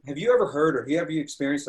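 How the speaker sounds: usual speaking voice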